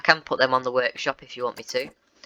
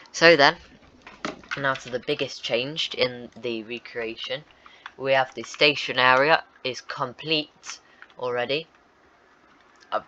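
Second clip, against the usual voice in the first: ordinary delivery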